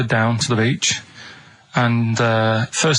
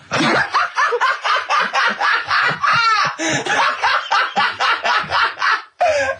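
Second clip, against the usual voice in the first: a sudden shrieking burst of high laughter; a real, uncontrolled cackle at the punchline; big laugh. Real, not acted.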